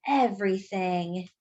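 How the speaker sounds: ordinary delivery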